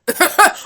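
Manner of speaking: sudden high laugh; loud burst of laughter. Real, not acted. Vlogger laughs at the wrestler's name, loud burst of laughter.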